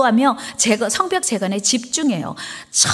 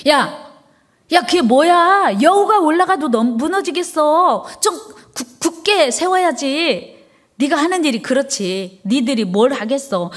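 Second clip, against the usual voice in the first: slow, higher sneering jeer; mocking taunt; 3 semitones higher than usual. Tobiah sneering at the wall builders, mocking. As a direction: jeer at the builders like a scornful enemy.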